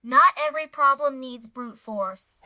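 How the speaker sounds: ordinary delivery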